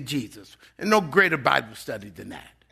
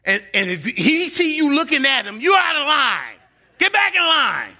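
higher, louder barked commands; drill instructor; 9 semitones higher, 4 dB louder than usual. Retold or imitated. Drill instructor yelling at a recruit out of line, loud bark. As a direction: yell like a Marine drill sergeant. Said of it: "the drill instructor would go".

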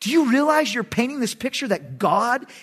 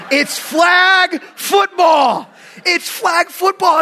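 loud, high, drawn-out exclamation; exasperated shout; 10 semitones higher, 7 dB louder, much slower than usual. Acted. Preacher dad on his son's rough flag football, exasperated shout. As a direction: cry it out slowly in disbelief.

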